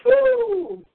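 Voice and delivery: high excited whoop; a sudden high woo of delight; joyful whoop. Real, not acted. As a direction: let out a happy shout. Preacher exclaiming in delight.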